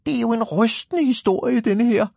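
loud, high little hero complaining; small character's indignant high outcry; high-pitched protest. Acted. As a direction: protest high over a bumpy ride. Shaken hero protests the bumpy ride.

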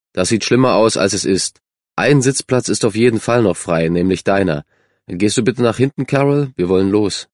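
ordinary delivery

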